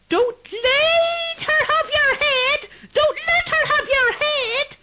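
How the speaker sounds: very high, slow ghostly shout; harsh ghost voice. Acted. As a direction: cry the warning like a spirit. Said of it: "A harsh voice rang out".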